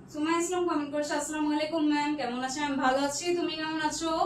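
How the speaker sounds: usual speaking voice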